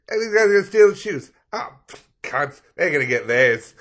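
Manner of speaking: loud, high mock Aussie heckle; mock Australian heckling the screen; Australian accent. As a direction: yell at the screen like an Aussie mate. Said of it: "He's like".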